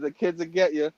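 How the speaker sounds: ordinary talk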